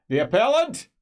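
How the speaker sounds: high, loud herald's cry from behind a door; called from inside. Acted. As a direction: announce the arrival through the wall. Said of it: "from inside you hear".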